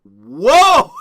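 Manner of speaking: loud, drawn-out "Whoa!"; surprised whoa. Retold or imitated. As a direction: gasp it out, caught off guard. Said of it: "I saw this and I was like".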